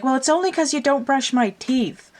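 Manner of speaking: high, bickering comic knight; three-headed knight. Retold or imitated. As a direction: retort as the other squabbling head. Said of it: "fighting amongst each other".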